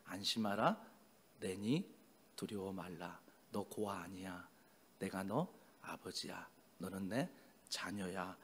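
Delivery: hushed, low, gentle comfort; tender reassurance. Acted. The Lord coming to the discouraged as their father, tender reassurance. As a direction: speak softly in short phrases with pauses.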